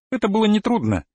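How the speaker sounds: ordinary delivery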